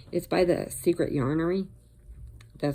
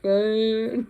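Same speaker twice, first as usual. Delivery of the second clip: sheepish grunt; 7 semitones higher, 5 dB louder than usual. Retold or imitated. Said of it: "I'm like".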